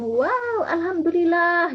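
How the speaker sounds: high-pitched delighted exclamation, drawn out; sangat ekspresif. Shown as a demonstration. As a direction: exclaim it big for small children. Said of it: "ekspresi sangat dimainkan". (sangat ekspresif, riang dan bernada tinggi)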